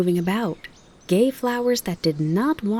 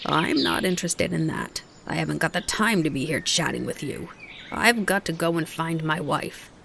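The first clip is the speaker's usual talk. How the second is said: grumbling. Acted. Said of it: "he grumbled".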